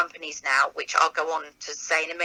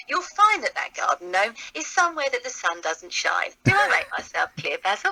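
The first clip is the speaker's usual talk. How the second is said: Sybil Fawlty. Acted. Wife telling off Basil at their hotel, nagging character voice. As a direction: scold your husband, pitch leaping up on key words. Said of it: "[name], as Sybil".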